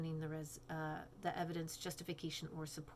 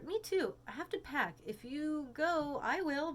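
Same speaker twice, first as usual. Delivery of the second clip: put-on high girl voice; Claire, high and tired; 7 semitones higher than usual. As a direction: friend who must pack, higher voice. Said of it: "go back to Claire".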